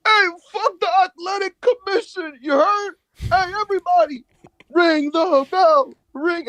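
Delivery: high, loud, strained imitation shout; mock a wrestler hollering at the whole crowd; Homicide mic rant. Homicide demanding the match start, high mock shouting.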